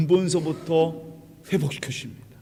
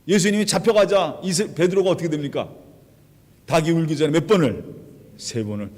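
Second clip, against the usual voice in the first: usual speaking voice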